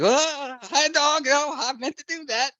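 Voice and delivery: high, sheepish sing-song; nervous baby talk. Retold or imitated. Narrator bumping furniture, sweet-talking the dog in a high childish voice. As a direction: play it cool with the dog, way up high.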